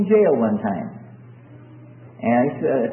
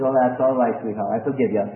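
tender forgiving parent; 6 semitones lower than usual. Acted. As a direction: soothe a small child who said sorry. Parent forgives little child.

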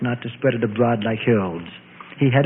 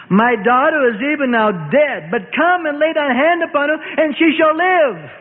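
high, pleading father begging for his daughter; desperate plea; 12 semitones higher than usual. Acted. Jairus begs Jesus to save his daughter, desperate high plea. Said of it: "burst forth emotionally, desperately".

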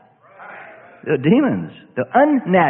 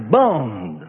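"Bond" drawn out slowly in a light English accent; slower, lightly English-accented "Bond"; 7 semitones lower than usual. Shown as a demonstration. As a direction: give "Bond" a rounded British vowel, slow. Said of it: "Little English accent".

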